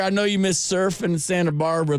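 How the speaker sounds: usual speaking voice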